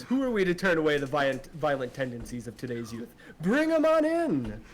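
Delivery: announcer whipping the crowd up to a climax; arena announcer. Acted. Arena announcer calling the fighter in, booming and building. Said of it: "And he begins".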